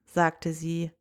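ordinary delivery, nothing marked

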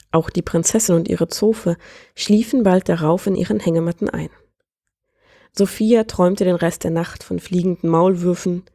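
plain reading voice

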